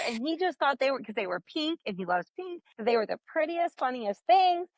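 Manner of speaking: normal talking voice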